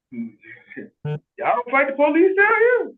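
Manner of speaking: high, drawn-out disbelief; retold amazement, slow and rising in pitch; astonished question. Asking Southern cousin about police, acted astonishment.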